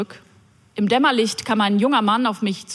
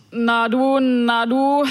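put-on drunken slur; 3 semitones higher, slower than usual. Drunk man on the night bus slurring at her. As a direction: slur "Na du, na du" like a drunk, words running into each other. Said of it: "lallte mir ein Daunenjackenträger".

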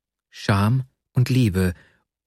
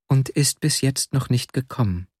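plain reading voice

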